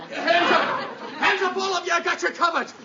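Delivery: high, barked arrest order; commanding shout. Acted. Cop catching the runaways, shouting hands up. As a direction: stop them cold.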